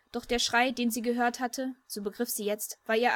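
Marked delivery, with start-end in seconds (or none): none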